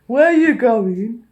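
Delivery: slow, loud mimicked challenge; obnoxious yelling. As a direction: holler after people walking away. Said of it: "that's how much you were yelling".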